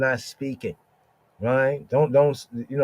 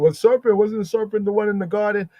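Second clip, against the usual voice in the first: higher, fast doubting voice; mocking skeptic voice; 7 semitones higher, faster than usual. Preacher mimics doubters, high and quick. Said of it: "'Cause some people are like".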